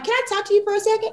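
quick, high mock-polite coworker voice; mimicking the coworker's fast, high request; imitating coworker. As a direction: rattle it off like a nervous colleague. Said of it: "She was like".

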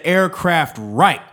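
slow, loud, high Aircraft right, each word hammered; angry slow yell; exasperated. Retold or imitated. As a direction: correct him angrily, hitting Aircraft right. Angrily repeating instruction.

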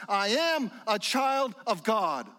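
high, stretched-out proclamation; imitated mountaintop yell. Preacher urging faith like that little kid's, mock screaming. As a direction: declare it slowly as if from a mountaintop. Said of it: "who just screams it from the mountaintops".

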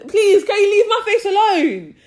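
fed up, near-shouting request; pleading yell at a nitpicker; exasperated loud plea. Retold or imitated. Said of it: "I just said".

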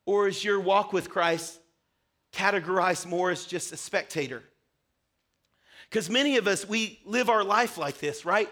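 ordinary talk, nothing marked

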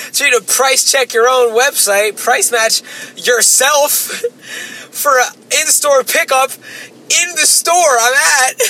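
high, slow, laughing disbelief. Acted. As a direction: laugh through the ridiculous rule. Mocking store policy, laughing.

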